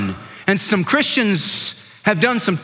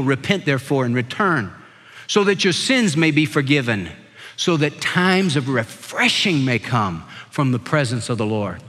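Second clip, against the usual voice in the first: solemn scripture reading; 6 semitones lower than usual. Acted. Reads Acts verse as scripture.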